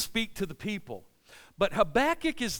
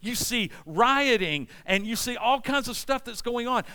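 normal talking voice

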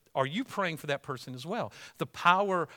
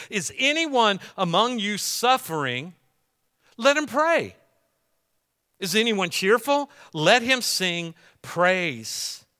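raised, emphatic scripture read; louder preacher reading of James; 4 semitones higher, 6 dB louder, slower than usual. Acted. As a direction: proclaim the verse to the congregation. Reads scripture aloud.

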